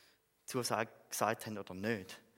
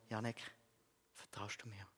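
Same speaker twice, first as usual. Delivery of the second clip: gentle voice of God; 2 semitones lower, 10 dB quieter than usual. Retold or imitated. God gently asking Janek if he trusts him, softly. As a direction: speak it softly, like a still small voice. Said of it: "die feine Stimme von Gott".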